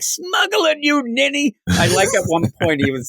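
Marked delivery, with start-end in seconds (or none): 0.0-1.5 high, shouted dummy voice; squeaky puppet shout. Retold or imitated. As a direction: snap at him like a puppet. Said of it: "He's like".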